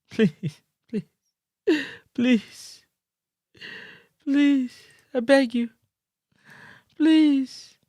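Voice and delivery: soft, feeble mock pleading; small, spaced-out pleas with long pauses; Steve McLaren begging. Host mimics the coach begging, softly pitiful. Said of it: "that's what Coach Steve McLaren begging".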